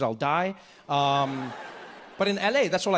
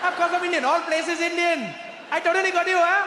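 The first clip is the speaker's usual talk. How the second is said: put-on high, loud Indian-accented waiter; indignant, high-pitched Indian accent; 12 semitones higher, 3 dB louder than usual. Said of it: "Wait, you, you Indian".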